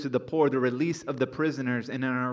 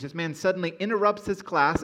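normal talking voice

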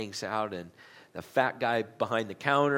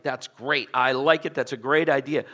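louder, bright burst of joy; delighted; 3 semitones higher, 10 dB louder than usual. A narrator's reading. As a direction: answer as if thrilled by the idea. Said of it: "He answered with delight".

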